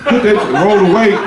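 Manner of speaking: high, hammed-up yell from the bathroom; mock yelling. Husband to his wife from the bathroom, high mock yell. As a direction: yell complaints through the door. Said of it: "he in the bathroom yelling out".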